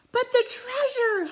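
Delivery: high, loud dismayed holler. Acted. Captain worries for treasure, loud holler. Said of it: "hollered Braidbeard".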